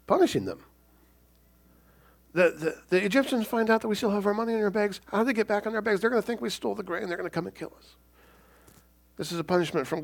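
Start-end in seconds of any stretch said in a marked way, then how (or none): 2.3-7.9 high, quick anxious fretting; voicing the brothers' rising fear; worried brothers. Brothers fearing Egyptian revenge.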